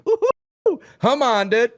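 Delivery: very high, loud whoop; excited cheer. Real, not acted. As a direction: cheer like a fan in the arena. Cheering for Rusev's return.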